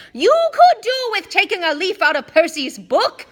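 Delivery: high, loud scolding yell. A narrator's reading. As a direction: yell it down at him. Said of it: "yelled Missus Weasley".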